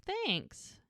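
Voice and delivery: a lightly voiced bashful son; high squeaky thanks. Shy thanks from Jack.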